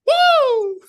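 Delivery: high, loud imitated "woo"; bus driver's whoop. Colleague mimics the bus driver joining in, high loud whoop. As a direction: join in with a long war cry. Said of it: "then the bus driver goes".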